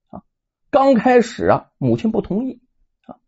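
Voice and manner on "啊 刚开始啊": ordinary delivery, nothing marked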